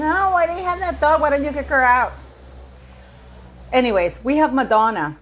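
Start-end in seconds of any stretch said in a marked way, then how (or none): 0.0-2.1 higher, whiny mock complaint; mocking complainers. Mocking emailing viewers, higher whiny complaint. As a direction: parody the viewers who nag her about the dog. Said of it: "emails from you people complaining".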